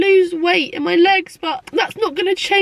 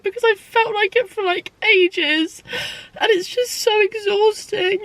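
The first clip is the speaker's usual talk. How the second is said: tearful; 6 semitones higher than usual. Real, not acted. Crying about body image.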